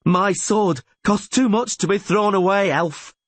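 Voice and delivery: high, fierce retort; defiant cry. A narrator's reading. Rayla refuses to yield to the elf, crying out. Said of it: "she cried".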